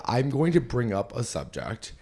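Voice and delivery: ordinary delivery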